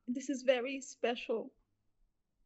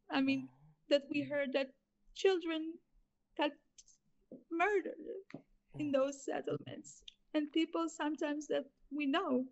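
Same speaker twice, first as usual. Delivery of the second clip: real tearful voice, higher, halting; crying; 4 semitones higher than usual. Real, not acted. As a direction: hold back tears between the words. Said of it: "sorry for crying".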